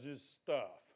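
ordinary talk, nothing marked

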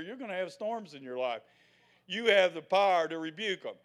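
usual speaking voice

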